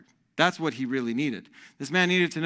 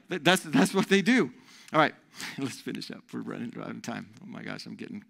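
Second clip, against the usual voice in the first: ordinary talk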